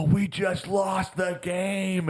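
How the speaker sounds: high, drawn-out mock wail; hammed-up yell, stretched out slowly; retold yell. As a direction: act out yelling the terrible news, high-pitched. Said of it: "I yell the worst words possible".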